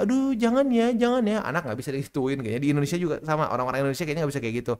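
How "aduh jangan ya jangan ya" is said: high, pleading gentle voice; mocking soft parent. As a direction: beg the child softly, exaggerated. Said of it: "soft, soft gitu ya". (suara orang tua lembek, tinggi memohon)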